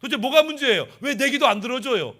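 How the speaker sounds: argumentative protest. Acted. People confront God over unanswered prayers, demanding an answer. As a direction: press the grievance in short insistent bursts. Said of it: "이렇게 따지는 백성들의 모습".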